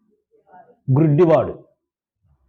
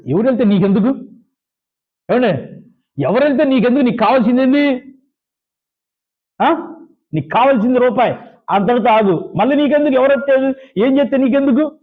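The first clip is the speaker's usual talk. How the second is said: higher, sharp telling-off of a beggar; high-pitched scolding of a pestering beggar; scolding rebuke; 7 semitones higher than usual. Retold or imitated. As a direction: put the nosy beggar in his place. Crowd rebukes blind beggar.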